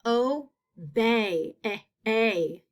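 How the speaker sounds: obey split, then eh, A on its own; isolated long A. Shown as a demonstration. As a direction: sound out the end of Obey as eh, A. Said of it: "I do hear a long A sound".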